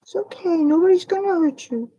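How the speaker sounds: high, gentle reassuring coo; soothing a scared bird. Real, not acted. Soothing a frightened baby bird.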